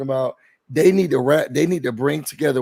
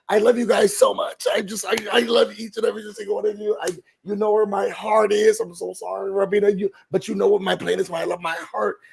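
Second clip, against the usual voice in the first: mimicking Chelsea; 5 semitones higher than usual. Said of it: "when we came back from the veto".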